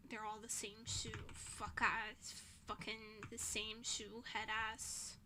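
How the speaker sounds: soft bitter muttering under breath; muttered grumble. Real, not acted. Grumbling about the roommate, softly under her breath. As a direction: grumble it to yourself. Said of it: "I'm so mad still".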